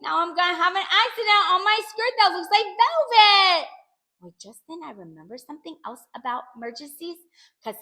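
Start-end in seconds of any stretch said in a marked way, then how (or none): 0.0-3.7 high, loud cry of dismay; panicked wail. A narrator's reading. Child about to have an accident, wailing in panic. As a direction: wail as disaster looms. Child fears wetting the skirt.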